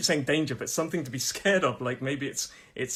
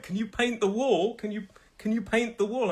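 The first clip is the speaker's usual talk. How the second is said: higher-pitched imitation of a wife's request; imitating his wife; 7 semitones higher than usual. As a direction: mimic a wife asking for a chore. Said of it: "my wife's like".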